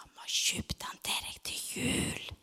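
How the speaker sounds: soft secret whisper; hushed parents' whisper. Retold or imitated. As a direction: whisper it into a child's ear. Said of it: "kviskret de i øret og sa".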